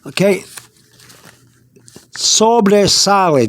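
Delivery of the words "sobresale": Sobresale said slowly, syllable by syllable, so-bre-sa-le; Spanish pronunciation demo. Shown as a demonstration. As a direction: carefully pronounce Sobresale the Spanish way, slower. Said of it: "This is how you pronounce it".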